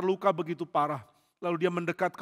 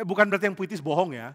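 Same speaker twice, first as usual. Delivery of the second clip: ordinary talk, nothing marked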